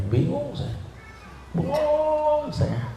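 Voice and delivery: mock bewildered, drawn out; confused. Shown as a demonstration. Preacher torn between child and parent, bewildered. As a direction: play up the confusion.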